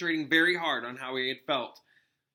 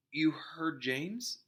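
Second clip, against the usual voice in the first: strange voice; 2 semitones higher, 4 dB quieter, much slower than usual. A narrator's reading. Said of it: "in a strange sort of voice".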